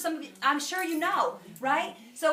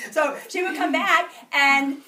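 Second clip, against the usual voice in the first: laughing through the words, a bit louder; bubbling laugh while telling the story; 5 semitones higher, 12 dB louder, slower than usual. Real, not acted. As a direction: giggle it out as you tell it. Laughing while retelling excitement.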